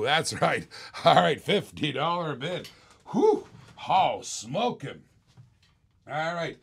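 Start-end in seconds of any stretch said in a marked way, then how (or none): 3.1-3.4 high, loud whoop of delight; excited whew. Real, not acted. Reacting to bid.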